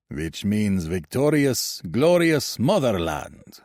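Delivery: put-on slow, boasting Russian accent; proud recruit boasting to the crowd in a Russian accent. A narrator's reading. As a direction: cheer it out in a thick Russian accent. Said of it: "with cheer and a thick, deep Russian accent".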